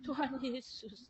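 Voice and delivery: slow, soft imitation of crying out; retold tearful calling. Patient crying to Jesus at midnight, imitated soft weeping call. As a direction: drag the name out softly, mimicking a weeper. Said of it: "Tengah malam dia nangis".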